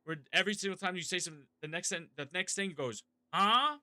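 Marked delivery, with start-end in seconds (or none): 3.3-3.8 confused huh. Retold or imitated. Reacting to confusing sentence.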